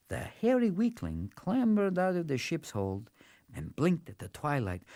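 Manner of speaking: ordinary delivery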